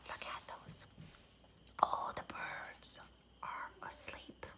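soft, fast unvoiced whisper; hushed whisper. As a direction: whisper so the birds stay asleep. Birds asleep, don't wake them.

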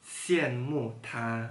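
word by word, long pauses between; slow, one word at a time; reading aloud haltingly. Shown as a demonstration. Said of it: "Coba baca pelan-pelan".

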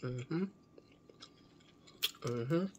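talking with mouth full; mumbling around food; chewing while talking. Real, not acted. Seen 2: Bite taken at -2; lips closed and working through the words.

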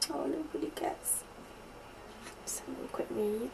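soft whisper, reading to herself. Real, not acted. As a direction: hush it as you skim the card. Quietly reading the card.

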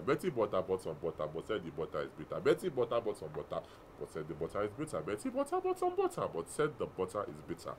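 tongue twister attempt. Real, not acted. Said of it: "So we are doing a tongue twister".

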